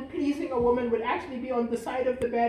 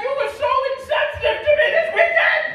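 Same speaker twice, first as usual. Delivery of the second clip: loud, higher mock outrage; mock angry complaint; 11 semitones higher, 11 dB louder, faster than usual. Partner accusing over a weekend, loud mock outrage. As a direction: shout the grievance, hammed up.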